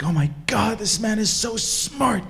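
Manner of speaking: loud, high-pitched amazed gushing; astonished. Acted. Students watching through the window, astonished and gushing. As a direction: marvel out loud, voice leaping up. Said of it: "they're astonished".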